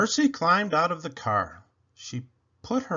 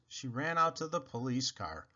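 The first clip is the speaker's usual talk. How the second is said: plain reading voice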